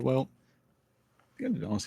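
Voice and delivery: ordinary talk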